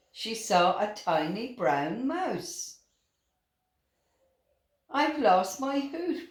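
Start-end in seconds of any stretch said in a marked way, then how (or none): none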